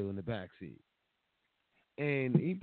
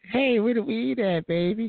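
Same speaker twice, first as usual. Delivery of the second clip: high put-on voice; eager for weed; party girl; 10 semitones higher, 3 dB louder than usual. She answers the driver, high eager put-on voice. Same mock voice again.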